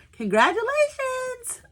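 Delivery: high, drawn-out excited congratulations; exclaimed. Acted. Reading her art fair acceptance to viewers, excited. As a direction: sing out the good news, slowly.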